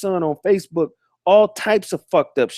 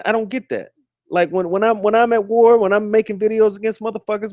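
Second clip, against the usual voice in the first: usual speaking voice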